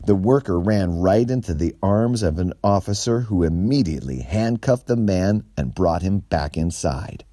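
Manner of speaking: plain reading voice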